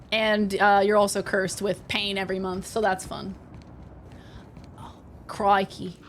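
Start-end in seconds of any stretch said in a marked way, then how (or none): 4.8-6.0 put-on slow, low, poor Australian accent; attempted Australian accent. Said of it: "I try to do my Australian accent".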